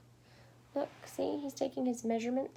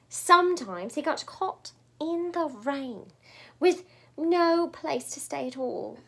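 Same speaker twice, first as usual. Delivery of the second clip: plain reading voice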